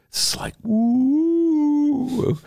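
long, drawn out high ooh; trippy ooh. Acted. Host describing a drug high, dragged out ooh. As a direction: stretch the ooh like a drug rush.

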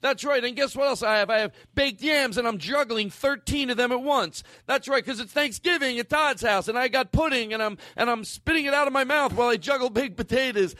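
high-pitched, breathless showy rambling; manic excitement. Acted. Comic juggling meatballs for the cartoon, high and manic. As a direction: rattle off absurd boasts in a high excited voice.

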